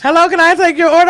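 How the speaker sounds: high, loud, quick server voice; drive-through order taker. Retold or imitated. Imitating the Checkers lady taking an order, high and quick. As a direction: rattle off a chirpy order-taking line. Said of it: "The lady, you know, from Checkers".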